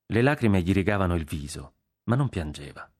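ordinary delivery, nothing marked

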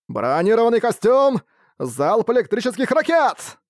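high, slow rocket callout; skill shout. Acted. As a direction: fire the missiles. Mech pilot fires rockets.

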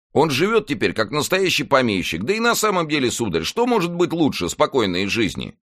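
high-pitched, animated servant's voice; protesting servant Planchet. Acted. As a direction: argue the case earnestly, pitched well up. Narrator plays Planchet arguing.

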